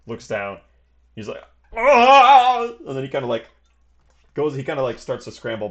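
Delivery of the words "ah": loud high scream of pain; sustained yell on finding a wound. Acted. Man seeing a dagger in his thigh, pained scream. Stabbed man looks down, cries out.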